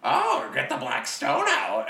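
very high, slow gull voice; squawky bird voice. Retold or imitated. Podcaster mimicking the gull pulling out shrapnel, very high squawk. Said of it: "And then he was like".

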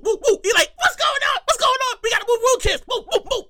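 high, urgent crowd-clearing call; panicked guard shouting orders; acted frantic security. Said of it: "And then you hear security".

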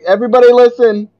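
loud announcing teacher call; playful mock announcement; mock teacher shout. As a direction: call the class to attention, loud.

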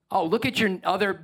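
usual speaking voice

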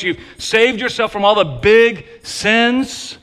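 sarcastic, overstated air-quote voice; ironic air quotes. Shown as a demonstration. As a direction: lift "big" high in ironic quotes. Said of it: "gigantic, overstated air quotes".

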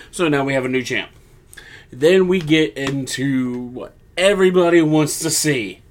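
ordinary talk

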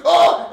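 loud, high "oh!" yell of rage; wordless angry scream. Retold or imitated. Driver venting out the rolled-down window, angry scream. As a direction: bellow out a frustrated cry. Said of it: "I just screamed".

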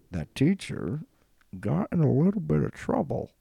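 high, loud mock-gossip delivery; hammed-up insinuation. Hinting at teacher's scandal.

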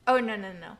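loud, quick oh no no; catching a mistake mid-list; sudden self-correction. Real, not acted. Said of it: "catches her own mistake".

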